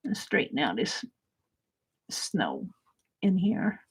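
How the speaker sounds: hushed, almost whispered. Real, not acted. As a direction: murmur it while concentrating. Careful detail work, hushed.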